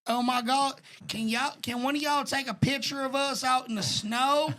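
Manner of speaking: hammed-up Southern accent asking a favor. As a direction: mimic a girl with a thick Southern accent. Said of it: "in the most southern accent possible".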